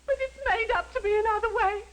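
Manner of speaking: hammed-up martyred sobbing; wronged, weepy servant; tearful. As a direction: sob through each phrase, drawing out the last words. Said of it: "You needn't cry, Miggs".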